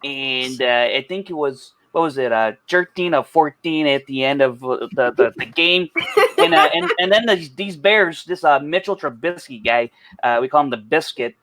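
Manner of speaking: thick, exaggerated Chicago accent. Shown as a demonstration. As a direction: lay on a heavy Chicago sports-fan accent. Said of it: "I'll do my Chicago accent".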